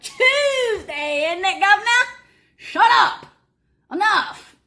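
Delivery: high, loud hammed-up British accent in a fight; mock British accent. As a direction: ham up a Cockney partner mid-argument. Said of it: "I'll just be like".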